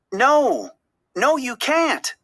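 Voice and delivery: high, slow desperate protest; pleading cry. A narrator's reading. Dory crying out as Marlin swims away, desperate. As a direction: cry out to stop him leaving. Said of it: "Dory cried".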